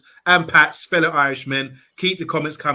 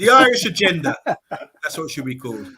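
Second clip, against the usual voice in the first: laughing; 4 dB louder than usual. Real, not acted. Laughing joke about panel.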